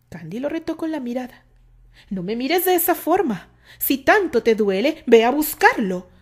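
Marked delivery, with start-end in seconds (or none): 2.1-6.1 loud, higher bitter dismissal. A narrator's reading. Terry angrily tells Candy to go after the other man. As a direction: send her off with wounded anger. Jealous quarrel on horseback.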